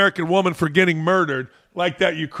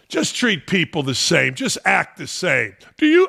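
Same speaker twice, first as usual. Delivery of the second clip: ordinary talk, nothing marked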